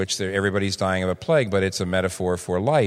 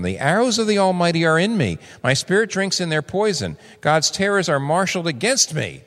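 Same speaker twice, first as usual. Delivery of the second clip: high, pained cry of a suffering man; anguished cry; 8 semitones higher than usual. Acted. Preacher voicing Job's anguish to his congregation, high and pained. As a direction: cry it out as if God were crushing you.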